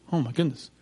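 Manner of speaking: ordinary talk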